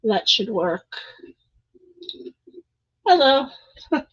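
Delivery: ordinary talk, nothing marked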